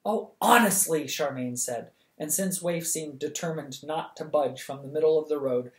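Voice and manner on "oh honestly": exasperated. Acted. Exasperated with the stubborn dog.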